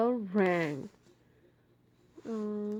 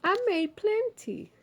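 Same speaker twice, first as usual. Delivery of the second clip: Ma's voice; 11 semitones higher, 4 dB louder than usual. Acted. Ma voiced in story.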